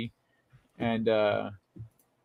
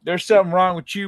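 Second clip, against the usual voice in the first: higher Southern American twang; put-on Southern accent; 4 semitones higher, 4 dB louder than usual. Teasing the other speaker back, mock Southern accent. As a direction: mimic it back in a good-ol'-boy Southern accent. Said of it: "red-blooded American talks like this".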